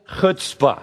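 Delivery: chutzpah said with a guttural h, stress on first syllable; Hebrew word. Shown as a demonstration. Preacher teaching the Hebrew word, low and slow. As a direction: say chutzpah as HUTS-pah. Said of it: "in Hebrew, the word is".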